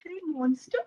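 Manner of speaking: put-on little boy timidly repeating a strange name; puzzled child. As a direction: ask it in wary disbelief.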